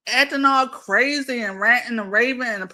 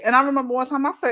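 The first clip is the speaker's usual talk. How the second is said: ordinary talk, nothing marked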